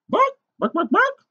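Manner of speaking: very high, loud hen squawks; hammed-up chicken "bawk"; dramatic chicken clucking. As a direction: cluck out short bursts like an excited hen. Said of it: "all the children heard was".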